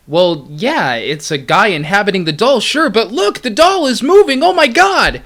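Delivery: building from uneasy to high panic; panicked amygdala. Pretend. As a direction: start unsure, climb into alarm. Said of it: "this is what they said".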